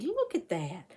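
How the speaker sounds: plain reading voice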